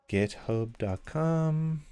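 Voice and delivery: spelling out a web address very slowly; slow dictation. Shown as a demonstration. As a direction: read the link slowly so people can type it. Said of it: "I'm gonna share a link".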